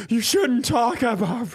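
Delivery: high, crying protest; high tearful retold complaint; retold whimper. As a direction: break down mid-sentence. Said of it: "I was like".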